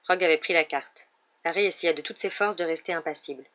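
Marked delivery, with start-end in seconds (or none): none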